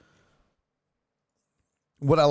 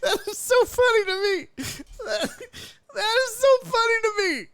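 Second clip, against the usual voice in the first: very high wheezing laughter; crying with laughter. Real, not acted. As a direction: laugh till you cry.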